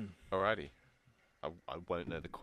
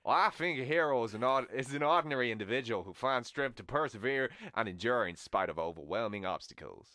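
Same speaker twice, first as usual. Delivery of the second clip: slightly raised Southern American voice; Southern American accent; 3 semitones higher, 3 dB louder than usual. Acted. Reciting a hero quote for the guesser, Southern American accent. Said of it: "S-, Southern American".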